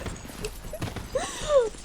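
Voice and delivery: long, very high soft giggle; amused laugh. Real, not acted. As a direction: let a high giggle spill out. Amused by remarks on own accent.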